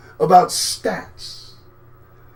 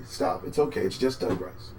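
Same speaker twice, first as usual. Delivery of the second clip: soft, low reassurance; calm them down gently; softly calming a startled viewer; mock soothing; 10 semitones lower, 11 dB quieter than usual.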